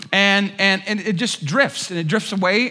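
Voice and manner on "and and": normal talking voice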